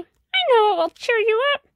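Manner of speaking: plain reading voice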